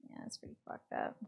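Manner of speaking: hushed aside, barely voiced; whisper. Real, not acted. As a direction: sussurrato a bassa voce. Aside during game dialogue.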